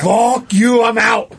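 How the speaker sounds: loud, high mock shout as the kangaroo; angry kangaroo. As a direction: yell it like a fed-up animal leaving. Voicing the kangaroo storming off.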